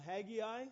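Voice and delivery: Haggai with a middle "ee"; Haggai in three syllables. Shown as a demonstration. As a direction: pronounce Haggai "HAG-ee-eye". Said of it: "You can pronounce it".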